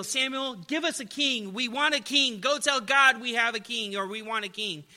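loud, high demand for a king; demanding shout. Retold or imitated. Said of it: "They shouted to Samuel".

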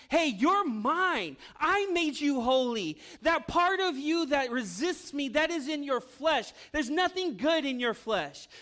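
loud, high, commanding divine voice; God speaking. Acted. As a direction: speak as God claiming his child. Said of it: "that's God saying".

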